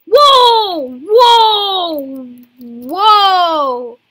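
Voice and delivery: loud drawn-out astonished whoas; amazed shouting. Real, not acted. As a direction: gasp loudly in amazement. Amazed at new creature.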